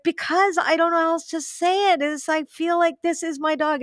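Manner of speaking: half-laughing high protest to her partner; flustered protest. Retold or imitated. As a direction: blurt it out helplessly. Defending herself to her boyfriend.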